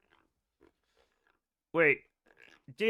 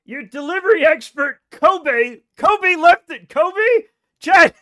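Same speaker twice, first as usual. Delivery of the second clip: higher, much louder excited yelling; excited shouting; 6 semitones higher, 10 dB louder, slower than usual. Real, not acted. Streamer yells to chat that Kobe is his driver, excited shout.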